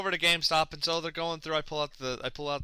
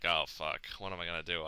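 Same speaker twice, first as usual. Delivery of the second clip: muttered dismay; 8 semitones lower, 9 dB quieter than usual. Retold or imitated. Retold dismay, very soft.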